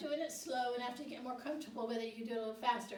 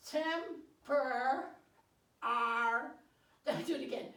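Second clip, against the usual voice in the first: ordinary delivery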